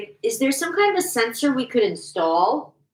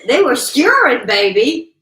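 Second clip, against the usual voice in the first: loud teasing emphasis; playful loud; 2 semitones higher, 9 dB louder than usual. Acted. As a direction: belt it out playfully.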